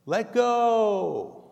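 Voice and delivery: high, loud commanding voice from above; booming God voice. Acted. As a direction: call it down like a divine command. God's voice commands.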